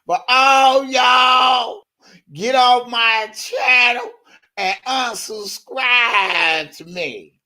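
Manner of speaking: high, slow, mocking woman's voice; high, slow mock of Boom Boom. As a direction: drag it out like the gossiping rival. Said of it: "Boom Boom talking like this".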